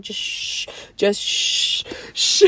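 whispered shh, shh, wincing; pained shushing whisper. Retold or imitated. Hurt on the grass, whispering shh to her husband. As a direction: hush him while the pain hits.

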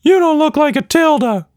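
cheeky little monster comeback; Quatch Monster voice. A narrator's reading. Creature teasing Tilda back, very high squeaky voice.

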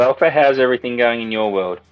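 usual speaking voice

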